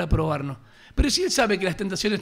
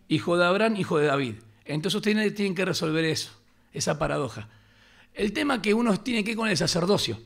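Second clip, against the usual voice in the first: ordinary delivery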